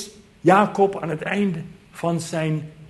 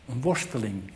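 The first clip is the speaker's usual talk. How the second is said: quieter, reflective repeat of worsteling; hushed echo of the point; soft echo; 4 semitones lower, 6 dB quieter than usual. Shown as a demonstration. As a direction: let worsteling sink in softly. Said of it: "Het is een worsteling".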